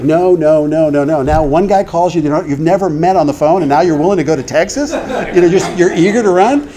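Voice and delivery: acted-out incredulous objection; indignant protest. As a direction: push back, retelling the quarrel in disbelief. Acted quarrel about moving.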